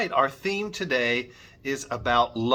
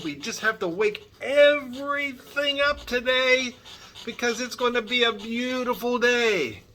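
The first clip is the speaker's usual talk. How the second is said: bright excited; 9 semitones higher than usual. Acted. Cheerful wake-up to children.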